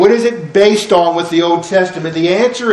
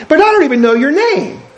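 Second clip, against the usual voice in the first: much higher, protesting Moses; pleading Moses; 9 semitones higher than usual. Retold or imitated. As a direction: Moses objecting to God in a high, pleading voice. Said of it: "Moses continues the conversation".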